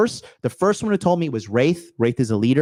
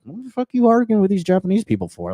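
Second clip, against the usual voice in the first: higher-pitched incredulous retort; exasperated; 5 semitones higher than usual. Retold or imitated. Retelling his reply to a girl who argued in the street, exasperated.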